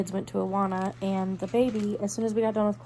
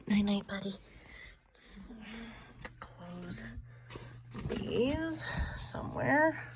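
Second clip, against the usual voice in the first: hushed goodnight whisper; sleepy bedtime whisper; 3 semitones higher, 4 dB quieter, slower than usual. Real, not acted.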